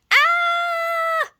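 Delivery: high, loud startled shriek; startled scream. Acted. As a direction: shriek as she sees the wolf. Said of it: "yelled Granny".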